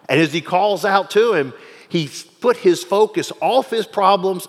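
loud, rising sermon voice; fiery pulpit preaching; preaching at full force. Acted. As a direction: preach it, building the fire.

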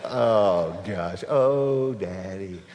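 a hint of a child begging for money; wheedling child.

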